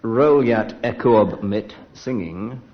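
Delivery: usual speaking voice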